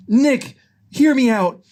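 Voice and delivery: high, slow appeal; pleading Judy. Acted. Judy pleading during the quarrel.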